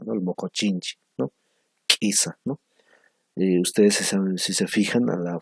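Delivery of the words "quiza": "Quiza" breathed out with no voice; whispered demo. Shown as a demonstration. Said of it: "conocemos como quiza". ("Quiza" susurrada)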